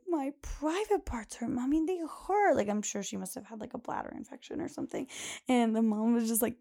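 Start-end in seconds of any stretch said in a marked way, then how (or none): 0.1-2.6 put-on whiny little girl. Host imitates a little girl wailing to her mom, high and getting louder. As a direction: wail it like a small kid.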